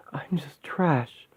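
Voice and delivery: hushed, broken whisper; despairing whisper. Acted. Woman crushed by the demon's taunts, whispering despair. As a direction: give up on yourself in a whisper.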